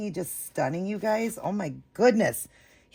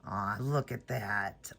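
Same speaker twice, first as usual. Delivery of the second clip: tender aw; 7 semitones lower, 6 dB quieter, much slower than usual. Real, not acted. Admiring the certificate.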